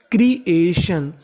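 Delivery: Creation slowly, split as क्री - ए - शन; syllable by syllable. Shown as a demonstration. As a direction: sound out each part of creation. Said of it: "तो meaning बनी". (Creation को हिस्सों में धीरे-धीरे)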